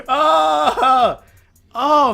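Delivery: ordinary talk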